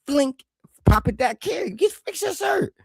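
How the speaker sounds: high, fussy scolding; a hint of a nagging mother; fussing mom voice. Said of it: "I've been like".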